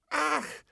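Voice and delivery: high sharp ach of disgust; a high disgusted gasp of ach; woman recoils, crying out in disgust. Acted.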